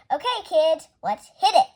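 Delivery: high, loud, eager call to start; rallying the kids. Acted. Amelia Bedelia rallies the kids to start hammering, loud and eager. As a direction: pump the kids up, let's go.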